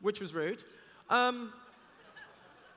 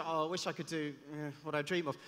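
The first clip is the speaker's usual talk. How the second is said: mock wistful; 5 semitones lower than usual. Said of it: "so many people who say".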